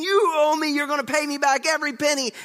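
high, loud creditor's tirade; acted angry demand. Servant shaking fellow servant over a debt, acted angry demand.

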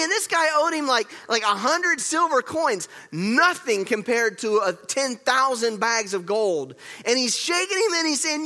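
loud, high, driving sermon storytelling; full-force preaching. Real, not acted. Preacher telling church how servant attacks his equal, full-force preaching.

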